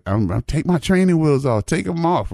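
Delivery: a hint of a cocky little boy; eager kid to mama. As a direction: pitch it up like an insistent kid. Child boasting to mama.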